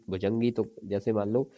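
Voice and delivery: ordinary talk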